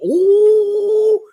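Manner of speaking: long, loud, high-pitched "oooh"; high "ooh". Real, not acted. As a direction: let out a sustained high hoot. Reacting to a chat message.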